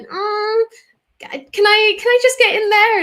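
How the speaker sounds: high-pitched, hesitant mock request; tentative plea. Asking a colleague to let her fix the text, tentative. Acting out own urge to fix it.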